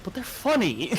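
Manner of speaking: high-pitched mock-defensive whine; pleading protest. Son told "don't say that" by mom, pleading protest. Retold protest to scolding mum.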